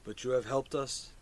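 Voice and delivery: low, soft fungal creature voice; hushed low mushroom speech. Acted.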